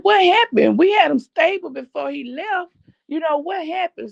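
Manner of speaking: retelling the doctor's loud, high shocked question. Retold or imitated. Doctor shocked by brother's death.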